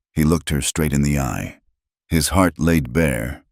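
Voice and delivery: ordinary delivery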